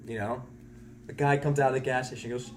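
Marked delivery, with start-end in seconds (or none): none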